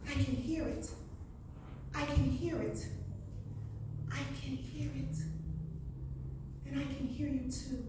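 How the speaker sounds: very soft poetic refrain; hushed, spaced-out ending of a poem; fading refrain. Acted. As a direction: let each repeated line fade away between long pauses.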